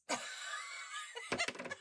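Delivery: a sudden, breathy high shriek of laughter. Real, not acted. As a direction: burst out in a squealing laugh. Hysterical laugh at own joke.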